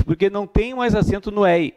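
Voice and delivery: lightly voiced student repeating the myth; mock common excuse. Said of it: "a justificativa é a seguinte". (imitando o aluno que repete o mito, leve)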